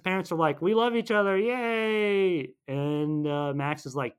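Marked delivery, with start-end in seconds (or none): 0.6-2.5 high, sugary mock joy; mock cheering. Recapping the parents' happy reunion, mock-joyful. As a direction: cheer with a long drawn-out final word.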